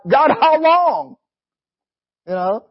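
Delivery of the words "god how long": higher, drawn-out question to God; questioning plea. Retold or imitated. Preacher voices the psalmist questioning God, slow high plea. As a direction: plead it slowly, pitched up. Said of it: "he's questioning God".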